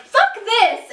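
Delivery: high, loud outburst; acted-out yell of exasperation; really loud. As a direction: explode in a retold furious shout. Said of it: "I'm, like, really loud".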